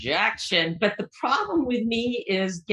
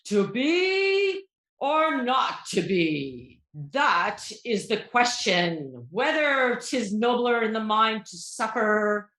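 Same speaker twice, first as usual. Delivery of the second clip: slow, drawn-out stage delivery with a theatrical voice; slow, grand mock-theatrical recitation; dramatic Shakespeare recital; much slower than usual. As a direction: ham up Hamlet like an acting student. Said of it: "So try to guess what this is from".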